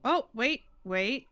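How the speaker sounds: ordinary talk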